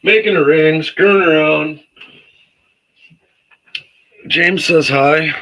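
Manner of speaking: loud, high voice across the room; yelling across the room. Real, not acted. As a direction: raise the voice to reach across the room. Talking to Zach across the room.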